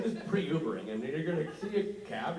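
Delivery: ordinary delivery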